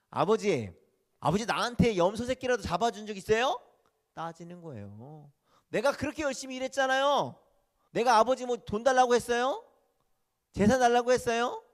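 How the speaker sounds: high, loud indignant complaint; resentful son. Acted. Eldest son confronts his father, loud and indignant. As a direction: throw the grievance back at him.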